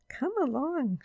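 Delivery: high, lightly voiced girl; coaxing girl. A narrator's reading. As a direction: voice a girl beckoning. Judy coaxes the bear.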